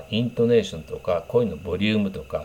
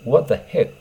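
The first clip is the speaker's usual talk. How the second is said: dismissive exclamation; 6 semitones higher, 4 dB louder than usual. Shown as a demonstration. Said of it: "こういう言い方する人もいますから".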